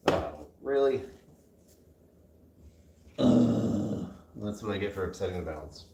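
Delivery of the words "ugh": loud annoyed groan; frustrated groan. Real, not acted. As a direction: groan at the mess. Balance upset.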